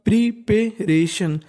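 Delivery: Preparation stretched out very slowly; teacher modelling preparation slowly; slow syllable by syllable. Shown as a demonstration. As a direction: sound out preparation slowly for learners. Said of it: "तो meaning बन गई".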